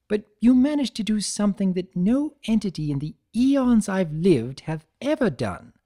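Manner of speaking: playful trickster Discord. Acted. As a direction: tease him like a smug jester. Discord voiced in the story.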